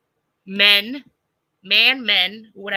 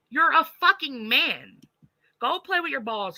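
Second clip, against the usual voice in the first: scornful rant; 7 semitones higher, 4 dB louder than usual. Real, not acted. Scolding the men meddling in women's business, scornful. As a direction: spell it out with contempt.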